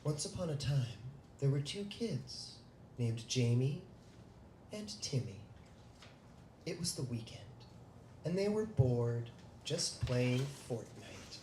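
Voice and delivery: loud, dramatic storytelling voice; spooky story narration. Acted. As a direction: read it like a campfire ghost story. Reads kids' scary story aloud.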